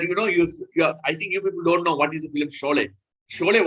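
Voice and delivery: normal talking voice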